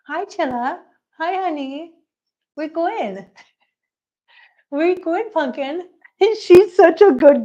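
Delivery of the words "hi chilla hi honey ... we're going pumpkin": pitched-up coaxing to a pet; cooing pet talk. Real, not acted. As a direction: sweet-talk the kitty. Cooing to the cat.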